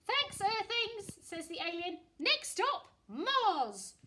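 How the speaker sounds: high, loud alien voice. Acted. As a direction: play a cheerful alien.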